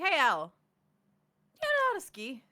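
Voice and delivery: high, loud hail in character; calling out. Acted. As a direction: call over to someone, pitch jumping up. Player's character hails Al.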